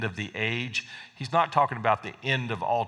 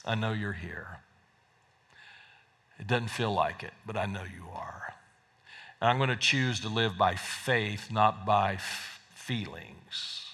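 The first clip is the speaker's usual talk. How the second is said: soft, hushed words to Jesus, fading to a whisper; quiet prayer; 5 semitones lower, 5 dB quieter than usual. Acted. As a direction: pray it softly, almost under your breath.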